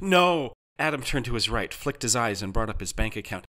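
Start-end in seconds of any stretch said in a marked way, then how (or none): 0.0-0.5 loud, high cry of dismay; raised, horrified denial. A narrator's reading. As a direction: cry out in disbelief. Customer discovering he was charged.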